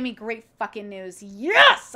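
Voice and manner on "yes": high, very loud burst of joy; real elated outburst; triumphant shout. Real, not acted. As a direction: yell it out in celebration. Celebrating great news from doctor.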